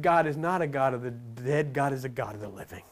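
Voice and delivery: low, solemn quoted voice of Jesus; quoting Jesus solemnly. Retold or imitated. Preacher quoting Jesus to the room, low and solemn. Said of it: "He said".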